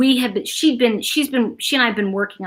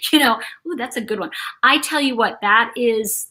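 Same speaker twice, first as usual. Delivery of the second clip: usual speaking voice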